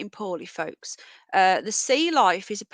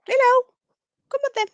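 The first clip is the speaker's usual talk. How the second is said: very high, louder coaxing pet call; treat voice; 7 dB louder, faster than usual. Real, not acted. Coaxing the rabbit over with the high treat voice. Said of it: "this is the treat voice".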